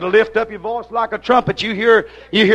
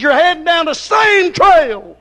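slow, high, loud warning; pulpit shout; 7 semitones higher, 4 dB louder, slower than usual. Acted.

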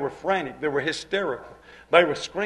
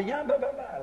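imitating cooing bird; 6 semitones higher, 7 dB quieter than usual. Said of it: "He'd say ... like a little bird".

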